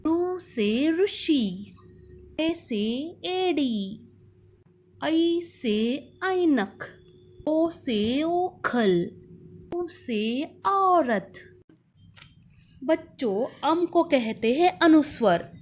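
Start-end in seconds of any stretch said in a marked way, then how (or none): 0.1-11.3 slow teaching recital. Shown as a demonstration. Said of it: "स्वर को चित्र के साथ पढ़ेंगे".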